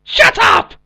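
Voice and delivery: high, loud comic yell; shouted shut up. Acted. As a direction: bellow it at the top of your voice. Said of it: "I challenge you to a shut up off".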